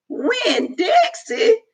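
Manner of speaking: put-on old woman hearing the dog's odd name; amused surprise. A narrator's reading. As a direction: savor the odd name in disbelief. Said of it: "Gloria Dump said".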